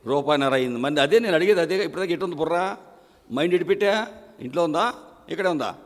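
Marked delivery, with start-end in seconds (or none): none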